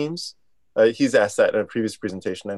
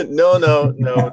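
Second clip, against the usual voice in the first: laughing; 4 semitones higher, 5 dB louder, much slower than usual. Real, not acted. Laughing about being overheard.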